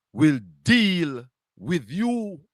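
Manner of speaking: "will deal with you" drawn out very slowly, each word weighted; slow solemn warning. Real, not acted. Host warns the critic that the Almighty God will judge him, slow and solemn.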